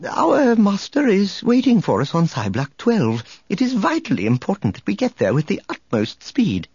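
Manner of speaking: high, prim, urgent droid voice; earnest protocol droid. Acted. Droid explaining his urgent mission to a reporter, prim and high. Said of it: "three P O explained".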